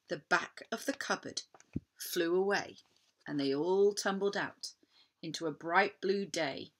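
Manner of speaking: usual speaking voice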